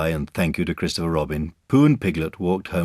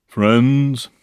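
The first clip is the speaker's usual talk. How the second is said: pompous speech-maker, higher and louder; Eeyore; 7 semitones higher, 7 dB louder than usual. A narrator's reading. Eeyore addressing friends at the party, pompous. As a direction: open an impressive speech. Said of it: "coughed in an impressive way and began to speak".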